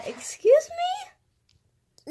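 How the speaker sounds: very high, slow squeal; outraged excuse me. Pretend. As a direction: shriek it in mock outrage. Mock outrage at being called annoying.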